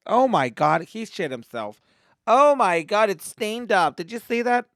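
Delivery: loud, high-pitched mock horror; shocked onlooker. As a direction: exclaim it like a scandalized bystander. Shocked onlookers acted out at pool.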